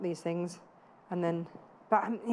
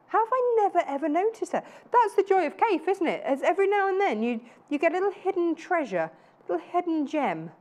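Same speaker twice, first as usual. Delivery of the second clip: higher, bright-eyed gushing over a hidden find; thrilled discovery, much higher pitch; excited delight; 7 semitones higher than usual. Real, not acted. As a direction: gasp it out like you just spotted treasure. Host thrilled by new fabric.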